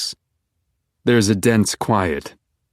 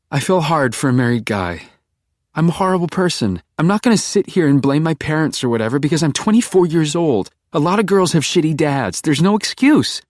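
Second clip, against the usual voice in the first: put-on higher, self-accusing young woman; Beck's voice; 8 semitones higher than usual. A narrator's reading. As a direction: confess it with forced bluntness. Beck confessing.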